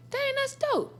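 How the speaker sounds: impressed. Retold or imitated. Impressed exclamation.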